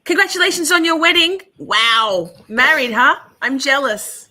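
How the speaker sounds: gushy congratulations to a chatter; gushing excited greeting. Real, not acted. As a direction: burst with delight for a friend. Excited greeting to viewer Chaos.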